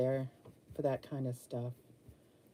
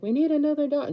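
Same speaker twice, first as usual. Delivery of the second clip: mimicking family; 10 semitones higher, 5 dB louder, faster than usual. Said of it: "Jeff and Ariel are both like".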